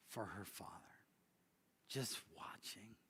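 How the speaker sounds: a whispered storyteller's aside; hushed whisper. A narrator's reading. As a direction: drop to a whisper.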